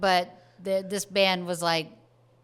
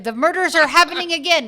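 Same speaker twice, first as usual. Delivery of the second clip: high, loud, hurried cop outburst; accented cop impression; 9 semitones higher, 9 dB louder, faster than usual. Podcast host quoting the film's cop, accented cop impression. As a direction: imitate an alarmed accented policeman. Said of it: "He also has an accent".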